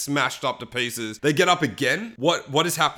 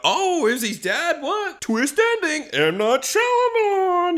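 high, slow, stunned disbelief; mock shock; slower than usual. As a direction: gasp at the big twist. Mock shock at twist ending.

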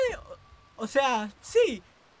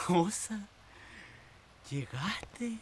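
susurro; 3 semitones lower, 12 dB quieter, much slower than usual. Acted. Criada llega sin anunciarse.

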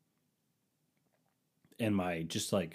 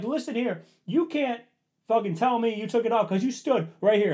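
much higher and louder ranting; retold yelling; 13 semitones higher, 6 dB louder than usual. Husband insisting wife watched him wrap it, loud retold anger. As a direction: lay into her. Retold argument, his line.

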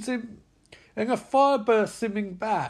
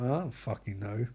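low, quiet resignation; acted dejected mumble; defeated father; 9 semitones lower, 9 dB quieter than usual. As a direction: murmur it, worn out.

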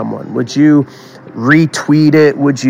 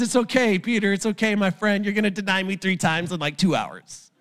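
Jesus calmly telling Peter he'll deny him; gentle Jesus; 7 semitones higher, 9 dB quieter than usual. Acted. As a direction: ease him down like a friend.

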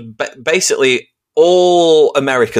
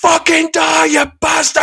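mock football fan shout; slower than usual. English football fans abusing a player, high shout. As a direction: scream it like a furious fan. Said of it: "It's like".

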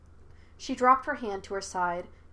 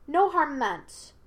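higher, slow, raised voice; calling out; 6 semitones higher, 5 dB louder, slower than usual. A narrator's reading. Aang reassures the hidden spirit, calling out. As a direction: call out reassurance. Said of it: "he called out".

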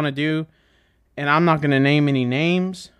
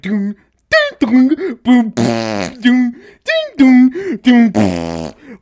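loud beatboxed drum beat, booming and dinging; beatbox the stupid intro beat; 7 semitones higher, 7 dB louder, much slower than usual. Retold or imitated. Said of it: "It's like".

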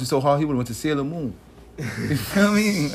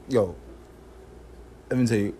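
ordinary talk

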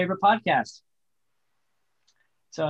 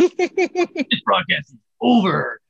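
slow, loud, higher official decree; mock stern announcement; 7 semitones higher, 8 dB louder, much slower than usual. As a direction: pronounce it like a solemn verdict, slowly. Mock shutting down the stream.

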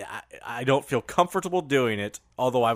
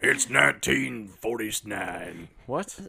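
grizzled prospector; 3 semitones lower, 5 dB quieter, slower than usual. Pretend. Prospector bit continues.

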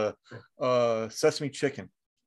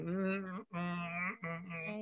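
soft closed-mouth refusing hums; child refusing vegetables, mm mm; 3 semitones higher, 13 dB quieter, slower than usual. Retold or imitated. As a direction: clamp lips and hum no like a child. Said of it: "I do that whole".

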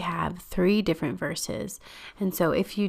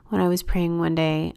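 ordinary delivery

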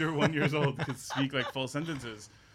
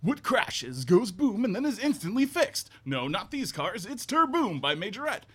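higher, slow, big toy-ad announcer read; kid commercial voice; 7 semitones higher, 5 dB louder than usual. Retold or imitated. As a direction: sell the toy car like a kid in an ad. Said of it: "it was like".

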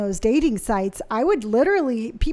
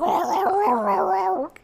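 mock bear gurgle; 7 semitones higher than usual. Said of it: "He's like".

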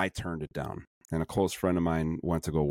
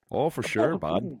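put-on Canadian accent; 6 semitones higher, slower than usual. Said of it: "Canadian, you gotta say".

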